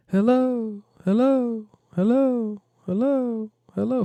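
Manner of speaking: high hellos fading away; long echo hello. Shown as a demonstration. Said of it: "it'll be like".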